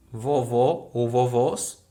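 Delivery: "Vovó ou vovós" slow, the final syllables stressed; last syllable stressed. Shown as a demonstration. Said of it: "Sílaba um". ("Vovó ou vovós" com a última sílaba forte)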